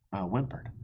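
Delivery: usual speaking voice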